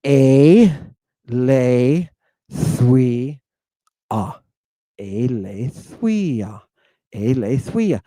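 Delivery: "Aletheia" as ay-lay-THWEE-ah, with a W glide; slow Greek "Aletheia", sounding a W after the th; digamma pronunciation. Shown as a demonstration. Teacher modelling the digamma, "Aletheia" drawn out as ay-lay-THWEE-ah. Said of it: "does everybody hear the digamma".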